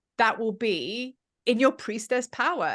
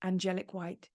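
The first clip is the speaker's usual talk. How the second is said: guided meditation; 4 semitones lower, 9 dB quieter than usual. Acted. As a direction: lull them gently into the vision.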